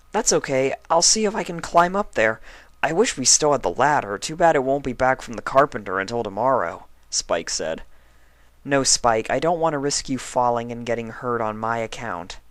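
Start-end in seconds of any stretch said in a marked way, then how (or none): 0.1-6.9 high, eager little dragon voice; young dragon Spike. Acted. Spike reassures sick Twilight, high young dragon voice. 8.7-12.4 slightly higher tired pony voice; a hint of a weary patient; lightly voiced sick unicorn. Twilight asks Spike for a book, lightly voiced tired pony.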